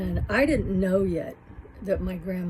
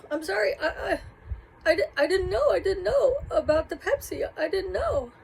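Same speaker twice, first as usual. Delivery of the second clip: put-on high, pleading little-girl apology; frightened child; 11 semitones higher, 3 dB louder than usual. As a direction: beg forgiveness like a scared seven-year-old.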